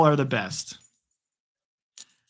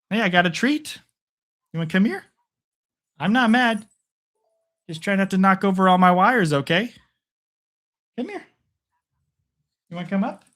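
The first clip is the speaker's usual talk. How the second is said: higher sing-song pet talk; coaxing the dog; 5 semitones higher than usual. Real, not acted. Calling his puppy over after it knocked things down, high coaxing voice. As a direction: coax a nervous puppy over with a treat, higher.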